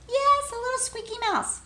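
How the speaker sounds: ordinary talk, nothing marked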